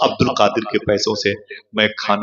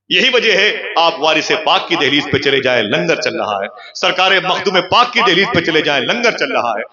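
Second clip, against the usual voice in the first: impassioned preacher building to the punchline; fiery preaching; 7 semitones higher than usual. Acted. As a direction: preach it to the crowd. Preaching at full force.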